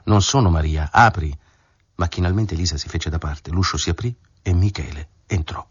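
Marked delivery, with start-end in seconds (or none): none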